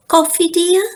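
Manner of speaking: Mama sweetly offering coffee. Acted. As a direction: offer it brightly to sleepy husband. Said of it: "asked Mama".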